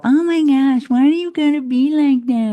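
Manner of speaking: loud, high-pitched mock whining; exaggerated wail of exasperation; bitching and moaning. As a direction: act out a whiny complaint at full volume. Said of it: "You'd have been bitching and moaning".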